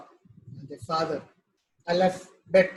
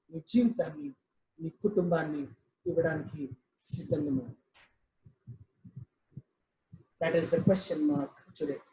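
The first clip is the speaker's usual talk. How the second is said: softer, intimate appeal; hushed altar call; 7 semitones lower, 5 dB quieter, faster than usual. Acted. Pastor quietly urging the congregation to give their lives. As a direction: draw them in quietly to surrender.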